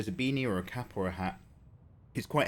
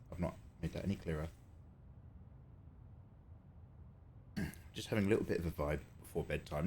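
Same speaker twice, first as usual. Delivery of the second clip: quiet; 5 semitones lower, 9 dB quieter, faster than usual. Real, not acted. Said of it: "We keep it nice and low".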